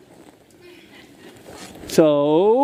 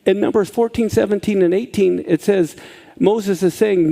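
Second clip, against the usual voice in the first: normal talking voice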